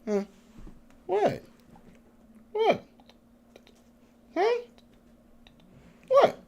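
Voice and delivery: very high soft squeak at the puppy; high doggy-talk squeaks; squeaky pet talk. Real, not acted. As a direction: coo up high like talking to a pet.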